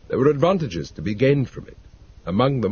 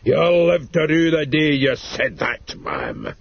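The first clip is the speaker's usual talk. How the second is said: malevolent mutter; 5 semitones higher, 3 dB louder, slower than usual. A narrator's reading. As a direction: threaten her slowly, full of spite. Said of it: "he muttered malevolently".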